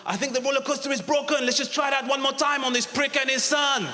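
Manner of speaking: high, shouted mock translation; imagined ride attendant. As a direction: call it out high, imitating the attendant. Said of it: "he must have gone".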